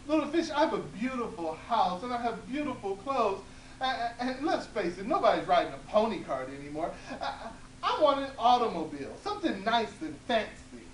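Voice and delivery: high, loud laughing demand; greedy old woman. Acted. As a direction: wheedle for the car with a giggle.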